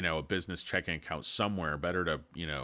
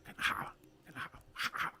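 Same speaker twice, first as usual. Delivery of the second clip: whisper; 7 dB quieter, faster than usual. Acted. Calling out to the chat.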